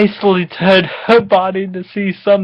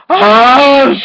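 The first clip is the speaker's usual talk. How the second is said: high loud hammed-up cry; mock scream; 7 semitones higher, 6 dB louder than usual. Vanessa screaming as she climaxes, mock scream. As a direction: let out a mock orgasmic scream.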